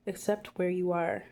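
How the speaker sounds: ordinary talk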